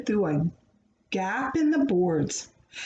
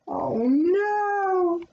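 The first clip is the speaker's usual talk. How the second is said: mock dismay; 8 semitones higher than usual. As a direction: gasp it for the children. Reader reacts to lost jar.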